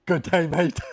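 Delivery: high, loud dopey Aussie reply; Jim Carrey's mock Aussie. Quoting Dumb and Dumber to the viewer, bad Aussie accent. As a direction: play a clueless guy doing a bad Aussie accent. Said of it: "He's all".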